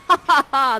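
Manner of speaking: loud hammed-up villain laugh; gloating laugh. Prospero gloating over disarmed Ferdinand, loud laugh. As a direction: cackle in triumph.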